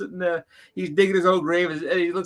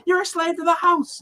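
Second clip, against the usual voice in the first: much higher, louder mock rant; imitating angry comedian; 4 dB louder than usual. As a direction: rant like the fed-up homeowner. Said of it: "yelling and screaming".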